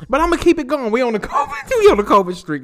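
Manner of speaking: loud, high hype about his streak; hyped. Real, not acted. Host crowing to listeners about his streak, loud and high. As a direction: crow it like a winning streak.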